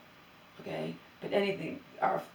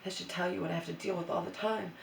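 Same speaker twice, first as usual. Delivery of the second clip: ordinary talk, nothing marked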